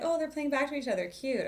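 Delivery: high-pitched adoring voice; cutesy, sweet high lilt; gushing coo. Retold or imitated. Cooing at musicians trading melodies.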